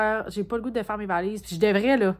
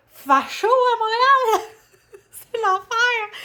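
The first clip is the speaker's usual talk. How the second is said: much higher, slow, laughing groan about the heat; exaggerated heat complaint; 7 dB louder, much slower than usual. Real, not acted. Back home, complaining to viewers about Montreal heat, laughing groan. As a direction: drag it out like the heat is unbearable.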